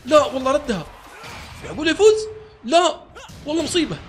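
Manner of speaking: high and loud, alarmed; real panic at the screen; panicked excitement. Real, not acted. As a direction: cry out as the loss looms. Nearly losing the match.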